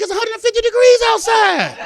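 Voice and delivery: high, loud comic outcry; protesting shout. Acted. Child sent to sit in the hot car, protesting shout.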